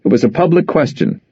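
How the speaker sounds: ordinary delivery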